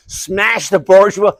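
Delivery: loud, high, slow American sloganeering; rally comrades in a put-on American accent; American Spart accent. Spart arriving at university in Liverpool, mock American accent. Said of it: "doing the American Spart accent".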